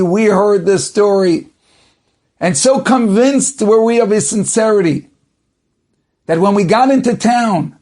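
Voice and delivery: judge's proclamation. Retold or imitated. Judge telling the court how the competitor confessed, emphatic proclamation. Acted judge announcing his findings.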